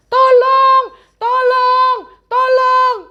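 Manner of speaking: high loud frantic calls; mock terrified shouting; panicked cries for help. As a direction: scream for help in fear. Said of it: "Mereka berteriak-teriak".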